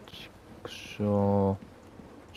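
soft breathy curse under the breath; whispered curse. Real, not acted. Gamer finding no good loot, whispering a curse. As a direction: curse to yourself, barely voiced. Muttered curse while looting.

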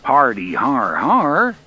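loud, slow, scoffing fake laugh; sarcastic mock laugh. A narrator's reading. Engineer scoffing at a suspected prank, sarcastic. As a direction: scoff with drawn-out, loud har-hars. Engineer mocks the supposed joker.

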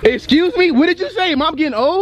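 playfully affronted, rising disbelief; mock-offended disbelief. Real, not acted. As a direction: pitch it up as if insulted. Child said yes, getting old.

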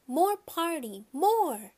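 put-on high, pleading toddler demand; high, insistent little-kid cry; child crying out. A narrator's reading. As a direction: wail it like a kid refusing to leave. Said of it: "He cried".

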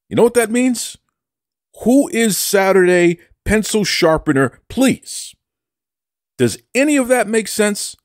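high-pitched boastful translating; hammed-up know-it-all delivery; mock triumph. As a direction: translate it high, as if hugely proud. Mock-triumphant translation of his Spanish.

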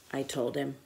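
usual speaking voice

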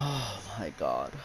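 ordinary talk

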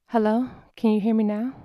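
calling into phone. Acted. Calling into a bad phone line.

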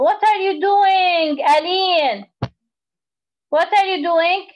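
high, sharp, exasperated telling-off. Real, not acted. Teacher scolds child Aline mid-lesson.

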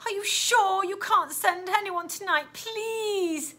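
put-on high girl's plea; beg, drawing out the last word; high-voiced, anxious begging; scared pleading. Scared Stella begs for help.

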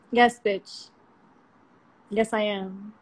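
ordinary delivery

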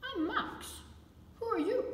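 high squeaky voice for little Max; high puppet voice. Acted. Max the puppet greeting the children, high and bright. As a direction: pop it up high like a cheeky puppet.

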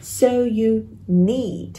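"need" held on a long "ee"; slow "So you need", stretching "need"; long ee vowel. Shown as a demonstration. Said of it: "Âm này là âm y dài".

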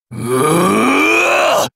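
drawn-out rising yell; wordless war cry climbing high; slow, swelling scream of effort; long battle cry. Acted.

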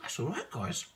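chewing while talking; talking between bites; mouth full. Real, not acted. Seen 1: Bites sushi at -7; still chewing and swallowing while speaking.

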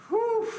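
high, loud blown-out whew of relief; relieved whew. Real, not acted. Manager after the meeting, high loud relieved whew. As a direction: let the tension out in one big breath.

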